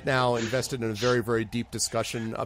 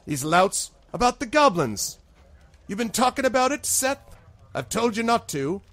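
high, loud scolding constable; raised, reproachful character voice; glaring constable; 11 semitones higher, 5 dB louder than usual. Acted. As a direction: scold the bartender as the village constable. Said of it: "she glares at the".

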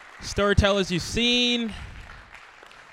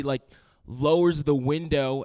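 usual speaking voice

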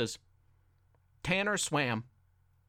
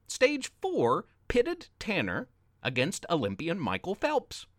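plain reading voice

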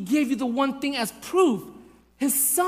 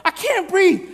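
put-on high, slow yell of a pinned child; imitating a kid's strained, panicked shriek; mock panicked cry; 11 semitones higher, 5 dB louder, slower than usual. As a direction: cry out as if being crushed, hammed-up. Imitates pinned son freaking out.

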